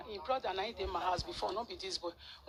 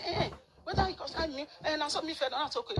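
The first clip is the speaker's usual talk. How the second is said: dismissive retort; 6 semitones higher, 5 dB louder than usual. Retold or imitated. Quoting the mother's careless reply.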